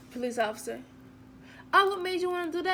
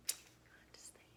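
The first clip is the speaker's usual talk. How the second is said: whisper; 26 dB quieter, faster than usual. Retold or imitated. Waitress sympathising silently.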